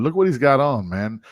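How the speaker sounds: ordinary talk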